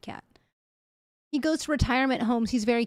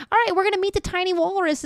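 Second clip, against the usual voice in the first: excited; 7 semitones higher, faster than usual. Real, not acted. Excited announce to chat.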